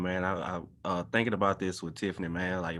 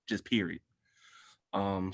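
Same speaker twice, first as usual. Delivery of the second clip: ordinary talk, nothing marked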